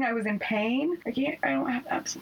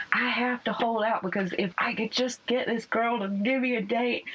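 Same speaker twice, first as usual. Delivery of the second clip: country southern drawl. Retold or imitated. Quoting her date in a mild Southern accent. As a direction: play up a light Southern country twang. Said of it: "it's even more country in my head".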